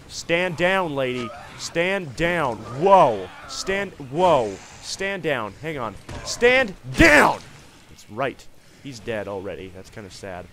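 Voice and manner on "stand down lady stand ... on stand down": loud repeated commands, ending in a shout; commanding. Real, not acted. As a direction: order the horse to stand down. Commanding the horse to stop.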